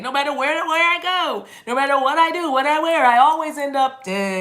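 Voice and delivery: high, loud mock lament; hammed-up catchphrase delivery. Shown as a demonstration. Reader showing how you say it, hammed-up high loud lament. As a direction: wail it theatrically, then drop on the eh. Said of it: "this is how you say it".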